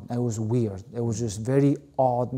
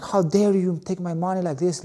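higher, retold indignant demand; hammed-up accusation, pitched up; mock outrage; 6 semitones higher than usual. On the phone to the bank about his house, mock outrage. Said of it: "I went Albanian on the guy too".

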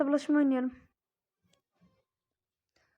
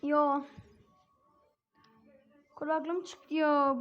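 ordinary talk

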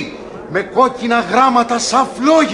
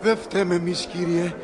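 quiet, softer begging; hushed plea; 2 semitones lower, 10 dB quieter than usual. Acted. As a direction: whisper your repentance. Crowd begs forgiveness.

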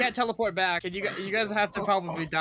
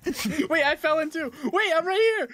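high, loud startled yelps; excited; 12 semitones higher, 3 dB louder than usual. Real, not acted. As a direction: blurt it out in surprise. Falls in, surprised and laughing.

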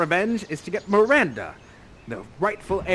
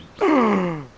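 loud theatrical gasp; shocked gasp; 5 dB louder than usual. Acted. Prospero's accusation heard, theatrical gasp.

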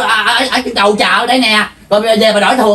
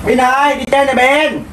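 calling out; 3 semitones higher than usual. Acted. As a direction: call a playmate out, slightly raised pitch. Neighbour calls child out to play. (gọi bạn í ới rủ đi chơi)

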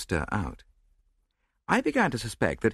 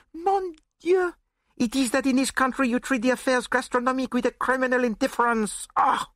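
put-on high, scandalised foreign-accented outburst; accented gourmet aghast, voice shooting up; Poirot, horrified; 3 dB louder than usual. A narrator's reading. Detective outraged at treatment of dinner, horrified accented outburst. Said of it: "held up his hands in horror".

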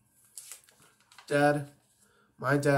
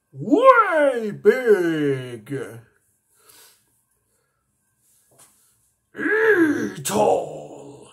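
loud, higher booming shout; giant alien cry; 6 semitones higher, 9 dB louder, much slower than usual. Acted. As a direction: bellow it like a giant. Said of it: "one of my favorite aliens".

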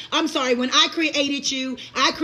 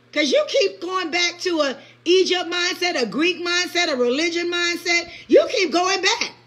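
ordinary talk

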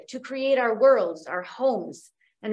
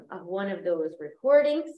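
ordinary talk, nothing marked

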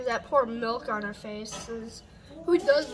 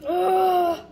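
loud groan from the burn; pained groan; 6 semitones higher, 10 dB louder than usual. Real, not acted. Reacting to hot sauce.

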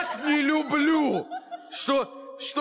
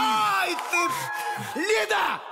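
pained yelp; 6 semitones higher, much slower than usual. Acted. Crab bite, crying out.

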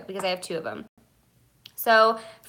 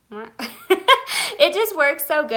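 laughing; 7 semitones higher, 6 dB louder, much slower than usual. Real, not acted. Laughing while praising bronzer.

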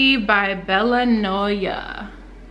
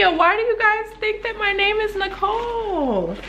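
high incredulous question; laughing at the wrong name; amused disbelief; 11 semitones higher than usual. Real, not acted. Vlogger asks viewers about her name, amused and high. I'm screaming.

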